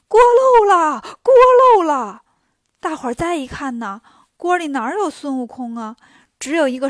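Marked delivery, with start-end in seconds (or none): 0.1-2.2 high, very loud yell; shouting in alarm. A narrator's reading. Fire tender yelling that the pot is leaking, alarmed shout. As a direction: call out an alarm at full voice. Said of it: "烧火的就喊".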